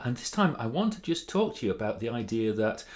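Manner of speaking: ordinary delivery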